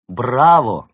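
loud, ringing cheer; praising. A narrator's reading. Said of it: "похвалил Улис". (громко, с похвалой)

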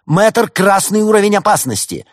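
high, loud red-alert cry; urgent, high-pitched warning shout; alarmed exclamation. A narrator's reading. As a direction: sound the alarm at full pitch. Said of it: "Антуанетта воскликнула".

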